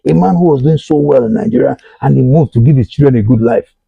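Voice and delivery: usual speaking voice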